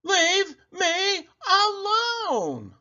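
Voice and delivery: put-on old woman shouting back at her family as she leaves, high and drawn out; shouted. A narrator's reading. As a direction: draw it out long and high like a fed-up old woman. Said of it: "the old woman shouted".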